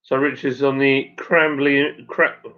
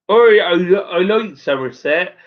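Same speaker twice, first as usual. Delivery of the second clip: lively, raised-pitch Somerset burr; hammed-up Somerset accent; 5 semitones higher than usual. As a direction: put on a playful West Country Somerset accent. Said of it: "do you talk like this?".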